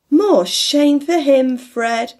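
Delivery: high, loud, slow indignation; indignant. A narrator's reading. Niece tells Fred off about Scrooge, loud and indignant. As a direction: scold him for his uncle. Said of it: "said Scrooge's niece indignantly".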